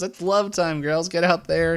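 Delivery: high, excited calling out; mock yell. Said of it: "runs in there and yells".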